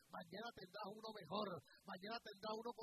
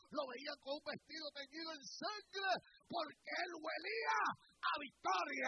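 preaching that climbs from steady to a shout; a preacher rising to a shouted climax; building sermon; 3 semitones higher, 7 dB louder than usual. Acted. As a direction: build the fire of the sermon higher and louder.

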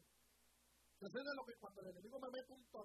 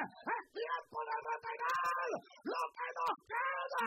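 loud rising sermon cry, slow and stretched; preacher building the crowd to a peak; preaching at full force; 5 semitones higher, 10 dB louder, slower than usual. Acted. Preacher exhorting the congregation, loud and rising.

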